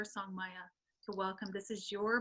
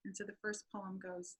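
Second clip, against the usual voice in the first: usual speaking voice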